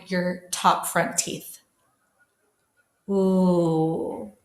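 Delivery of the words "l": a long held L with the tongue tip on the ridge; L sound demo. Shown as a demonstration. Teacher modelling a lone L sound for the class. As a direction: hold the L, tongue behind the top teeth. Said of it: "It should be on the ridge".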